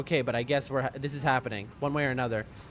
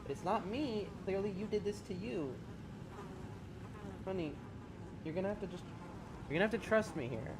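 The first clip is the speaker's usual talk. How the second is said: soft, higher reassurance to a cat; soothing pet talk; 4 semitones higher, 10 dB quieter than usual. Real, not acted. Streamer softly reassuring his panicking cat to trust him. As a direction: gently coax a scared pet to stay still.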